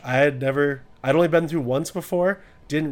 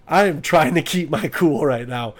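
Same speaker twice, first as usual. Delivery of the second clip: usual speaking voice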